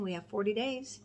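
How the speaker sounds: usual speaking voice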